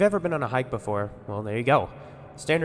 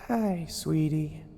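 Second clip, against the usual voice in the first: gentle; 7 semitones higher, much slower than usual. Acted. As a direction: coax a scared child tenderly. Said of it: "Amber called gently".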